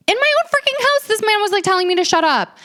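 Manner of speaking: high, indignant disbelief; outraged. Real, not acted. Telling listeners about the rude guest, outraged and high. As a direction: squeal it in outrage. Indignant retelling, much higher.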